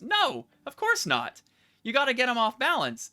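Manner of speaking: teasing. Real, not acted. GM teasing the player.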